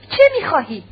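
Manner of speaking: startled wife crying out at her husband. Acted. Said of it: "آنا فریاد برآورد".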